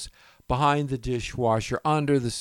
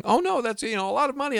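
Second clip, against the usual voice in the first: much higher, protesting wife voice; imitating his wife; 12 semitones higher, faster than usual. Husband mimics wife objecting to losing the chocolates, high voice. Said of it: "the former Missus Gross is saying".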